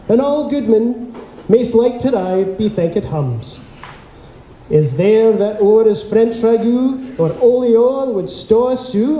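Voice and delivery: put-on Burns recited in a lightly Scottish accent; theatrical Scottish-accented reading of the haggis poem; Scottish accent poem recital. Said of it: "get used to the Scottish accent".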